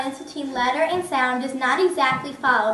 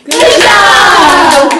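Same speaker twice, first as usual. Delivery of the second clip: loud praise; 4 semitones higher, 16 dB louder than usual. Real, not acted. Teacher praising the class.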